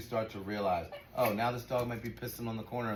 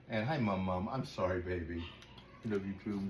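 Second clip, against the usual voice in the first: tender aside; 5 semitones lower, 7 dB quieter than usual. Real, not acted.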